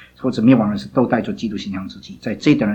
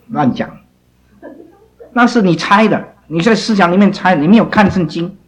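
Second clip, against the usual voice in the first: scolding; 8 semitones higher, 6 dB louder than usual. Real, not acted. Teacher rebukes student who guessed without reading, loud and sharp. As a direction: tell them off for guessing.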